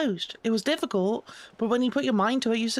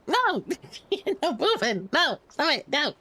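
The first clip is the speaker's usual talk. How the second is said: playful protest; 4 semitones higher than usual. Pretend.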